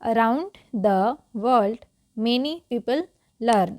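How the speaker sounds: word by word with pauses; deliberate model reading; slow read-along. Shown as a demonstration. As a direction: read slowly for learners to repeat after you. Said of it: "चलिए repeat करते हैं".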